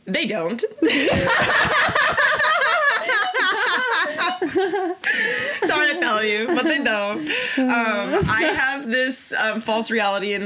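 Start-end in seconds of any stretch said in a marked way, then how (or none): 0.7-5.6 squealing laugh; high burst of laughter. Real, not acted. 5.6-8.1 talking through laughter; laughing. Real, not acted.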